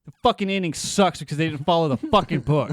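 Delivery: loud, high, exasperated rant; angry rant. Real, not acted. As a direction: vent about it loudly. Ranting about the ending.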